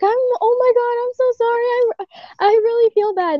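retelling her apology, high and flustered; frantic apology. Retold or imitated. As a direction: pour out the apology in a high panic. Retold frantic apology to him.

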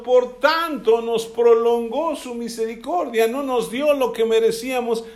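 preacher's passionate loud declaration; full-force preaching. Acted. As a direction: proclaim it with rising fervour.

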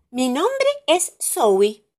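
put-on high, slow, proud little girl; cartoon princess. A narrator's reading. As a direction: announce her name with pride. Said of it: "volvió a decir en voz alta la princesa".

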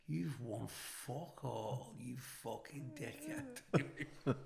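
very soft, drawn-out retold insult; slow, hushed mockery; quiet taunt. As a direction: stretch the insult out under your breath. Acts out his taunt back.

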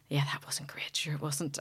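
whispered, sheepish admission. Retold or imitated. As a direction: whisper it like a husband owning up. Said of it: "he went".

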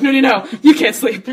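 loud, high mock shout; imitating mom slapping her awake; mom's alarmed shout. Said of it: "It's like".

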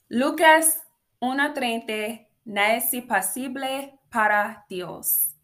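proudly declaimed Spanish, Puerto Rican style; Spanish with Puerto Rican flair. Shown as a demonstration. Reading Luke in Spanish to listeners, Puerto Rican flair. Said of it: "your girl is Puerto Rican".

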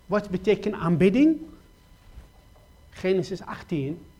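usual speaking voice

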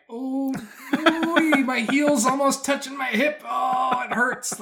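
high, loud pained mock groan; straining and wincing, half laughing; strained old self. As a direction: act out aching creaky joints. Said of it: "Now I'm like".

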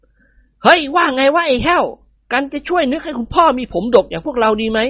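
high, playful voice of a joking son-in-law; cheeky young man. A narrator's reading. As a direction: tease him, then stop short. Nikorn asks servant Haew. (ลูกเขยพูดกับพ่อตาแบบทะเล้น เสียงสูง)

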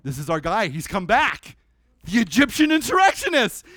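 getting worked up at the catch; excited soldiers. Retold or imitated. Commanders telling each other they caught him, building excitement. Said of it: "talking to each other like".

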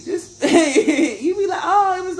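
high-pitched, helpless giggling as you talk; laughing through words. Real, not acted. Still cracking up while talking.

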